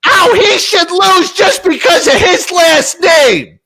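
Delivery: high mock shout; mocking fan. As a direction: lampoon the annoying woman.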